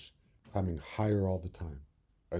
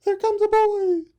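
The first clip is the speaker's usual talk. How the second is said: very high, loud warning; alarmed bird; 11 dB louder than usual. Acted. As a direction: warn of danger in a bird voice. Birds warn of boy.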